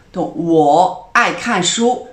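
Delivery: 我爱运动 said very slowly and loudly, each tone clear; slow model sentence. Shown as a demonstration. Teacher giving an example sentence, loud and slow. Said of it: "Un autre exemple".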